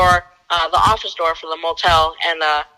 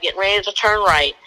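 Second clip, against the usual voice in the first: normal talking voice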